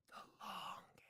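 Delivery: hushed, voiceless whisper; soft whispered aside; whispered echo. Pretend. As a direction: whisper it under your breath. Whispered echo of his own words.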